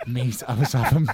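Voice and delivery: loud, high, through laughter; helpless laugh pushing the words up. Real, not acted. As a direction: crack up while telling it. Cracking up at own boast story.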